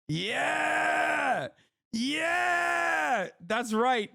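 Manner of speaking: gleeful high yells of yeah; triumphant shout. Real, not acted. Streamer cheering at being named the top Twitch streamer, shouting yeah. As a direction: crow over being called number one.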